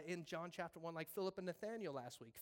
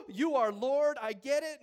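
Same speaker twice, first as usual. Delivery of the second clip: Nathanael bursts out, much louder and higher, suddenly believing; loud awed realization; 7 semitones higher, 16 dB louder than usual. Acted. Nathanael, stunned by Jesus' knowledge, much louder, awed exclamation. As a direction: shout it like the truth just hit you.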